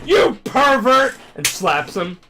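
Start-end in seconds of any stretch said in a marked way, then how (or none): 0.0-1.1 high, loud, drawn-out mock indignation; imitated scandalized yell; outraged accusation. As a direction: shout it slowly like an outraged girl. Acts girl slapping the teacher.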